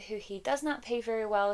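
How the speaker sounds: ordinary delivery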